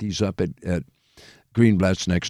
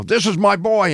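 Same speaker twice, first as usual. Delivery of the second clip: high, proud party introduction; big, high-pitched boast; proud showing-off; 9 semitones higher than usual. Retold or imitated. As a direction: show off your protégé to the room. Quoting Milty introducing him proudly.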